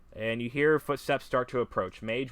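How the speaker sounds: usual speaking voice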